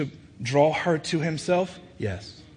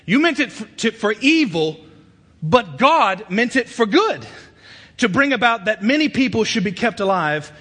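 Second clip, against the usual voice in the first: raised, impassioned preacher's voice; full-force preaching; 9 semitones higher, 7 dB louder than usual. Acted. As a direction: drive each phrase home, high and loud.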